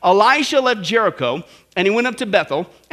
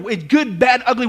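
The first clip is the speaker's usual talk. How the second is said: ordinary talk, nothing marked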